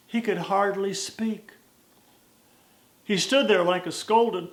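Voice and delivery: rhythmic storytelling cowboy verse; poem recitation. A narrator's reading.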